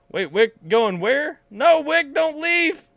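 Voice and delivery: high, loud begging not to leave; pleading cry. Real, not acted. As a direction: call out desperately for him to stay. Pleading with departing raider Wick.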